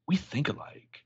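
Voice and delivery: whispered ominous line. Retold or imitated. Taylor telling Allison they think alike, whispered. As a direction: breathe it out as a secret. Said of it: "Taylor's like".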